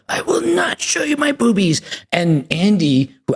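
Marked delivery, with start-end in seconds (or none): 0.1-1.8 squeaky high mock prim woman; high prim squeak, refusing the crowd. Mocking Kennedy refusing the crowd, in a high prim squeak. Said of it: "And she was like".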